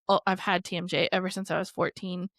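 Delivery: normal talking voice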